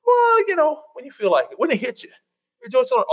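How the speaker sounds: sarcastic, lazy misquote climbing high on well; hammed-up casual version of the verse; mockingly casual misquote; mock misquote. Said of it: "they didn't say".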